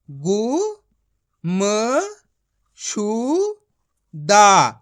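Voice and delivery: very slow, each syllable split apart. Shown as a demonstration. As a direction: sound out गु-म-शु-दा one syllable at a time, stretched. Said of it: "मेरा तरीका देखना है". (अक्षर-अक्षर अलग करके धीरे-धीरे)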